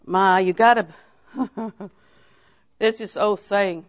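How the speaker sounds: ordinary talk, nothing marked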